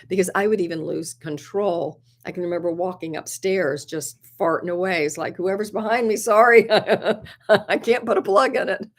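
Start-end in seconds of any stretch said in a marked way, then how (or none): 5.4-8.9 high, half-laughing mock apology over the shoulder. Retelling to viewers her embarrassed apology to people behind her, laughing. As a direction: giggle through a sheepish sorry.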